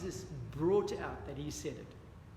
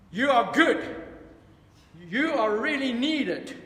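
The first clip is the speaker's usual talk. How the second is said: louder, slow, hammed-up pep-talk to the mirror; mock self-help affirmation; 4 semitones higher, 11 dB louder, slower than usual. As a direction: puff yourself up like a cheesy motivational book. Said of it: "They say, "Look at the mirror".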